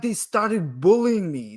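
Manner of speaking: usual speaking voice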